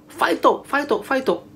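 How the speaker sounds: high-pitched Japanese cheering chant; mock cheering shout. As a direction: imitate fans cheering, pitched high without real volume. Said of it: "mga Japanese shouting".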